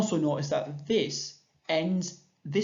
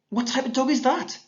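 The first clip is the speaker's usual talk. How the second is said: put-on high, curious child's question; voicing Jude; 10 semitones higher, 5 dB louder than usual. Shown as a demonstration. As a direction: ask it like a curious kid pointing at a dog. Teacher voicing Jude's question.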